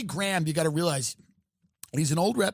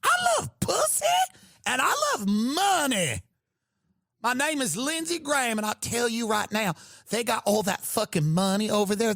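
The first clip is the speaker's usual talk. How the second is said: sassy Southern Graham; 5 semitones higher than usual. Retold or imitated. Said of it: "He goes".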